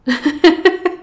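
rising gloating cackle; evil laugh. Acted. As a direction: cackle at the prey's fear. Goddess gloating over her prey.

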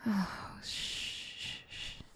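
soft whispered shushing that fades; hushing. Acted. Mommy hushing her crying sweetie, fading whispered shh. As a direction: hush them gently, trailing off.

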